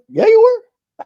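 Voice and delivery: high, loud put-on wife voice; mock wife insisting. Wife insisting he stuttered, high loud mock voice.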